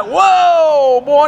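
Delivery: mock alarm at a tails penny; startled. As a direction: jump back from it like a snake. Jumps back from penny.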